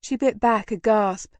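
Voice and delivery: ordinary delivery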